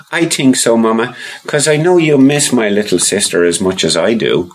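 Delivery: put-on high little boy voice; child voice. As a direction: voice young Rory, high and eager. Rory answers.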